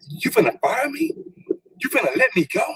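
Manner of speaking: can't believe what he's hearing; stunned disbelief. Retold or imitated. Fired man asking the HR lady in disbelief.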